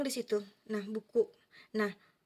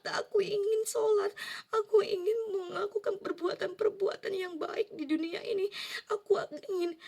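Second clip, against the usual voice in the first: high, loud, sobbing prayer; crying plea; 7 semitones higher, 5 dB louder than usual. Retold or imitated. Begging God in her dream, sobbing loudly. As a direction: weep and beg God for another chance. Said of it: "aku di situ nangis yang senangis-nangisnya".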